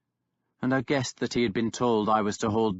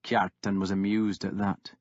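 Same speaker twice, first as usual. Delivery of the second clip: plain reading voice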